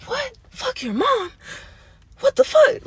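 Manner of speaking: high-pitched shocked squeak, loudest on the last question; shocked disbelief. Retold or imitated. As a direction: react like you can't believe your ears. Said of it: "I'd be so shook".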